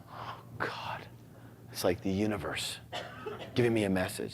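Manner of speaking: hushed, half-breathed wonder; awed whisper. Retold or imitated. Hearing the song, whispering to himself in awe. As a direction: breathe it out like a secret sign from above. Song on radio feels like sign.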